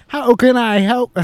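high, loud mock Chinese accent; hammed-up Chinese accent. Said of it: "Can you do your best Chinese accent?".

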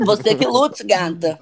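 high, loud sassy snap; sassy retort. Pretend. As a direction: throw it back with attitude. Mocking reply to complaint.

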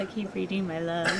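normal talking voice

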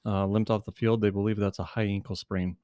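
ordinary talk

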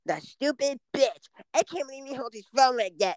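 squeaky cartoon kid sneering; Kyle. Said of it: "you sound just like them".

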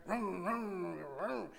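playful engine noise in the throat; motorcycle vroom. Retold or imitated. Acting out a classmate's motorcycle charade, engine vroom. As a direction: rev it like a kid's toy motorbike. Mimics the boy riding motorcycle.

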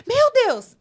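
high-pitched mock alarm; startled exclamation. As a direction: act out someone jolted on answering the phone. Acts out flustered phone answer. (susto encenado, voz bem aguda)

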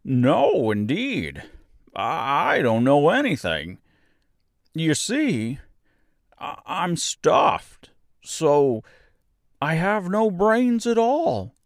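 high, halting, rueful admission; simple straw man, a little sorry for himself; sad scarecrow. A narrator's reading. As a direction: confess it slowly with mild sorrow. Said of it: "he answered sadly".

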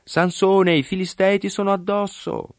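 high, sustained warning cry; grido d'allarme. A narrator's reading. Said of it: "Poi gli gridò". (grido acuto e allarmato)

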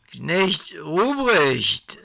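tipsy drawn-out babble; drunken slur. Acted. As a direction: slur it drunk on mulled wine. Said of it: "lallte Herbie immer wieder".

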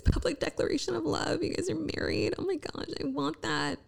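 high, soft longing; wistful gushing. Retold or imitated. Imitating her envious inner voice at friends' marriage, high and soft. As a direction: sigh it out with longing.

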